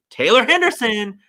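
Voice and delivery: high, emphatic name announcement; hammed-up indignant billing; mock outrage. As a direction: proclaim the name in playful indignation. Mock outrage at missing trailer credit.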